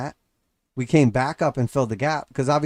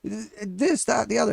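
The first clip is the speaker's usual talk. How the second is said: much higher, dopey rambling voice; mock the dumb neighbor blurting numbers; silly high neighbor babble; moronic neighbor; 9 semitones higher than usual. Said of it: "It sounds stupid".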